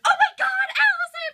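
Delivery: high, loud scream of a name; panicked shout. Retold or imitated. Friends locked in, screaming for Allison in panic. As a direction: yell for her in terror.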